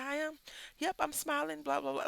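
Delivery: high-pitched, pleased-with-himself imitation; mock gloating. Uncle answering his fussing niece, gloating in a high voice. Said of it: "Like, he's gloatful, right? Gloating".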